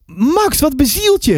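high-pitched, appalled reproach; mother calling out. A narrator's reading. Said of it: "riep mijn moeder". (moeder roept verwijtend, hoge stem)

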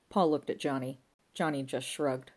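ordinary delivery, nothing marked

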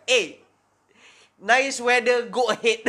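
a lightly faked Aussie charm; a hint of a nervous fake Australian accent. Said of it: "he ended up being like".

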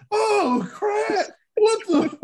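high, loud, near-tearful cry of dismay; choked up. Retold or imitated. Recalling a sad episode to friends, high tearful cry. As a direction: wail it as if the scene is breaking you. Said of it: "I was getting choked up".

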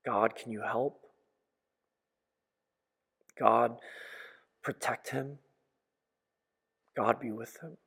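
soft, pleading prayer; a hushed plea to God for help; quiet desperate petition. Retold or imitated. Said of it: "to pray, to say".

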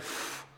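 a soft puff of breath as the weight lifts; relieved exhale. Retold or imitated. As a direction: let the burden go in one breath. Son's relief after being forgiven.

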